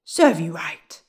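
loud, low grumpy dwarf; bad-tempered dwarf. A narrator's reading. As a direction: snap it back rudely. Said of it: "said the bad-tempered dwarf".

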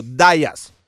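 "Díaz" said firmly as DYE-as; anglicized pronunciation. Shown as a demonstration. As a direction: give "Díaz" an "eye" sound, DYE-as. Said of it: "cómo se pronuncia tu apellido".

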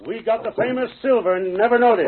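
high, loud, gloating; gleeful triumph. Acted. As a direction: savor the prize out loud. Boss gloats over capturing Silver.

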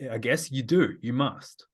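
normal talking voice

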